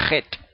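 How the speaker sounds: "jet" opening with a long rasped kh; strong throat scrape into "jet"; exaggerated chet. Shown as a demonstration. Teacher exaggerating the letter so it is noticed, guttural opening. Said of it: "Yo exagero un poco".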